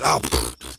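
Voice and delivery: sputtering spit sound; spitting in disgust. A narrator's reading. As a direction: spit out a bad taste mid-word. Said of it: "he started spitting".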